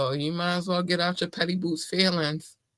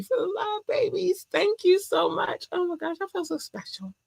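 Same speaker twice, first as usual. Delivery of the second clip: high-pitched gushing thank-you to fans; squeaky high voice. Acted. Podcaster thanking fans in a high gushing voice.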